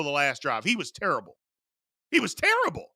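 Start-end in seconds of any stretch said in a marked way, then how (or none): 2.1-2.9 exasperated high-pitched repeat; emphatic repeat. Acted. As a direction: jump much higher on terrible. Repeats verdict on Purdy, emphatic.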